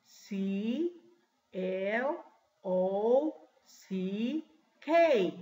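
letter by letter, long gaps between; spelling out slowly. Shown as a demonstration. Teacher spelling clock for class in English, letter by letter. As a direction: stretch each English letter for dictation. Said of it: "I will spell in English".

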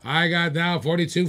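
ordinary talk, nothing marked

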